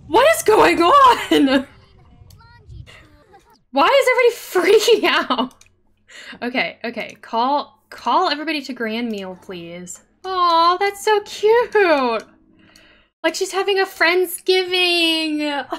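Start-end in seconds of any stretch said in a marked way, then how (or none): 0.1-5.5 loud laughing disbelief; exasperated disbelief. Real, not acted. Chaos at the party. 10.2-15.7 high, loud, very slow aww; drawn-out coo; delighted aww. Real, not acted. Sims gathering for dinner.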